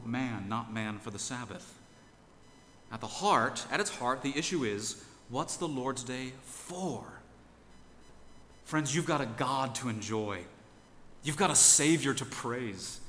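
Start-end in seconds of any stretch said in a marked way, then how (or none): none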